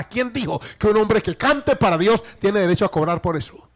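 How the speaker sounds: high, loud pulpit denunciation; full-force preaching. Acted. Preacher denouncing those profiting from piety, full-force preaching. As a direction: thunder the scripture at the congregation.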